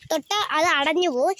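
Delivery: ordinary delivery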